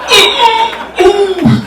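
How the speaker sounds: very high, held "ooh" noises; high-pitched oohing. Retold or imitated. Storyteller mimics the sound after the challenge, high oohing.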